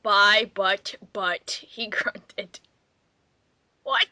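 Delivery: ordinary delivery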